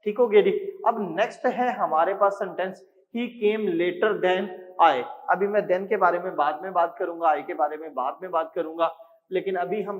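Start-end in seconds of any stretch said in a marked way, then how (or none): 3.1-5.0 very slow, each word set apart; slow dictation. Shown as a demonstration. Teacher dictating the next sentence to students, very slow. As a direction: read it out for them to copy.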